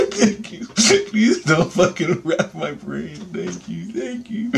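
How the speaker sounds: high, squeaky thanks through laughter; mock desperate pleading, cracking up; laughing gratitude. Patient thanking surgeon for caring for him, laughing. Retold grateful plea amid laughter.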